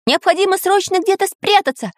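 very high, urgent character voice; Самоделкин, resolute. A narrator's reading. Friend proposes hiding, resolute high voice. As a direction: declare it firmly in a high voice. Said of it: "решительно заявил Самоделкин".

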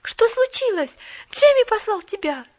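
put-on high, loud eager girl; girl pressing the boy for news; impatient girl questioning; impatient questions. A narrator's reading. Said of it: "нетерпеливыми вопросами".

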